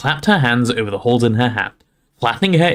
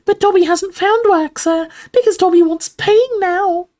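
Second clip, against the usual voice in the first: very high, drawn-out elf voice; squeaking house elf; 4 dB louder than usual. A narrator's reading. Dobby admits he wants paying, high squeak. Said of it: "Dobby squeaked".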